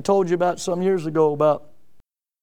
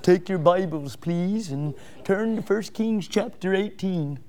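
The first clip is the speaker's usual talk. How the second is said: softer, lightly put-on English accent; mock English accent; 4 dB quieter than usual. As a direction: read the scripture call in a hint of an English accent. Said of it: "start talking with an English brogue".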